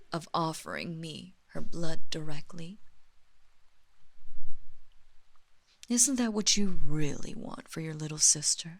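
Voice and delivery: loud insistent push. Acted. Vampire mistress pushing her servant about his sister. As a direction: press the point hard.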